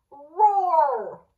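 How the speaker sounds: dinosaur roar. A narrator's reading. Dinosaur roars again.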